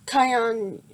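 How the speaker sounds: ordinary delivery, nothing marked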